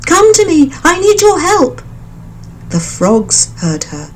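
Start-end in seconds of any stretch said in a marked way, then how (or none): 0.1-1.8 calling out. A narrator's reading. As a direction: summon your friends from far off. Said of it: "she called out".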